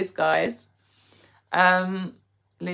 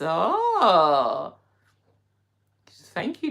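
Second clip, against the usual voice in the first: surprised; 3 semitones higher, 5 dB louder, much slower than usual. Real, not acted. Reacting to viewer's self-description.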